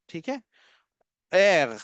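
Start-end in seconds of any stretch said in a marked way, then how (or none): 1.3-1.8 "एर" ending in a throaty gha sound; teacher models German R; German R demo. Shown as a demonstration. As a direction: let "एर" end in a guttural gh, like Ghajini. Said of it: "यही गा sound letter आर के एंड में आता है".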